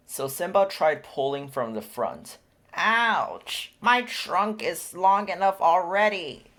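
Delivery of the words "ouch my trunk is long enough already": put-on higher, louder baby elephant protest; Bombo shouting. A narrator's reading. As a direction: yell in mock pain as a calf. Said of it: "Bombo shouted".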